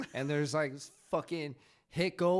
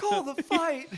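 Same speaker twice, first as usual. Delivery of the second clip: much higher, shouted to the referee; mock ringside call; 12 semitones higher, 5 dB louder, slower than usual. Repeated joking call-out.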